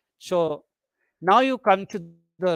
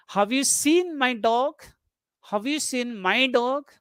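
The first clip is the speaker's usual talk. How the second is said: measured question with "dog" raised; yes-no question rise; 4 semitones higher than usual. Shown as a demonstration. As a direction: lift the pitch up into DOG, expecting an answer. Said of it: "questions which demand an answer yes or no".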